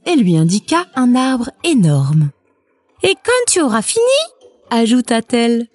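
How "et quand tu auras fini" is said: voix de poule aiguë et rapide; débite les consignes vite, haut perché; mère poule affairée. A narrator's reading. La poule ajoute une consigne. (poule de conte pressée, voix aiguë)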